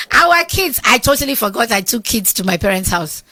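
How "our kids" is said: high, loud mock exasperation; exasperated shout. Husband reminding her of the forgotten kids, loud and exasperated. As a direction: blurt it out, half laughing in disbelief.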